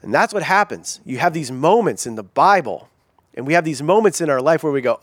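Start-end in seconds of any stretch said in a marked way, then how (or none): none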